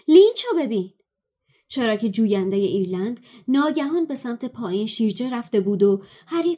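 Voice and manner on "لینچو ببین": high, loud shout. Acted. Harry shouting to his friends at the match, high loud shout. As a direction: yell it across the stadium. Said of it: "هری فریاد زد".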